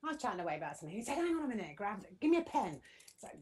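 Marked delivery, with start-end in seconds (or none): 1.2-2.8 higher, louder eager husband quote; quoting him in a sudden rush of inspiration; mildly hammed-up excited husband. Husband voiced in anecdote.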